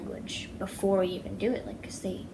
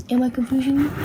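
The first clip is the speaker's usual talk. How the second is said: louder dog-talk question; 5 semitones higher, 8 dB louder, faster than usual. Real, not acted.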